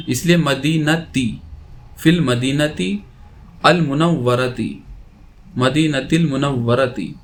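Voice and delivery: ordinary talk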